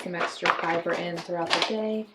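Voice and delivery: soft, close-mic talking; quiet. Real, not acted. Soft low-volume aside while drinking.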